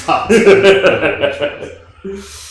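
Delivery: mock dramatic. Guest mocks his own betrayal.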